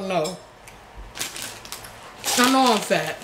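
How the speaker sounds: ordinary talk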